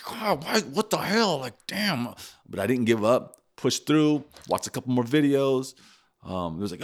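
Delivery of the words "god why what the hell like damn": high-pitched retold exasperation; exasperated. Retelling a car repair gone wrong, exasperated. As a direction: act out frustrated griping, pitched up. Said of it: "I'm so frustrated. I'm angry".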